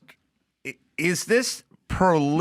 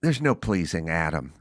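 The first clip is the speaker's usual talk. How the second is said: imitating a woman; 10 semitones lower than usual. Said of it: "I heard a woman go".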